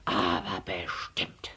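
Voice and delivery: low, slow, hushed vow; whispered threat. A narrator's reading. Herr Letscher leaving defeated, whispered threat.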